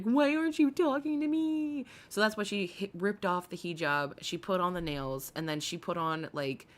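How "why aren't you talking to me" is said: whiny quote. Retold or imitated. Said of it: "she's over here like".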